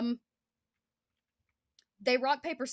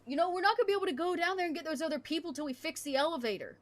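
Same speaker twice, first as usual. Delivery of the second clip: higher, fast soldier voice; 8 semitones higher, 3 dB louder, faster than usual. Acted. Soldier telling his squad the elevator must be fixed, higher and fast. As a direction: rattle off the bad news, pitched up. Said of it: "He goes".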